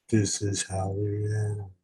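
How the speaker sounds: low, soft put-on voice; mock-solemn echo. Echoes own line in put-on voice.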